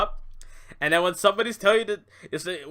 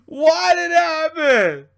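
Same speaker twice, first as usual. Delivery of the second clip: slow, louder fake sob through the words; mock crying; 6 semitones higher, 7 dB louder, slower than usual. Mocking disappointed fans, hammed-up fake crying. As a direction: ham up a tearful fan.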